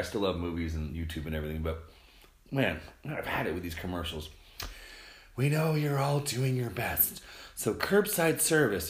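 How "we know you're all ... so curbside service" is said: slow, higher announcer parody; drawn-out mock ad read, pitched up; mock commercial voice. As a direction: mimic an overly reassuring TV ad. Mimicking tiresome commercials.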